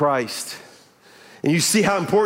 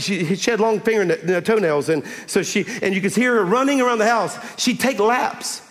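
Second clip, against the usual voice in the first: ordinary talk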